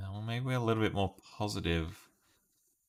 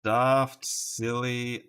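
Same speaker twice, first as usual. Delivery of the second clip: ordinary talk, nothing marked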